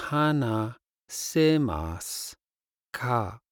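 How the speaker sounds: usual speaking voice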